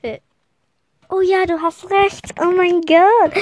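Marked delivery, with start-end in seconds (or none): 1.1-3.3 begeisterte Zustimmung. Acted.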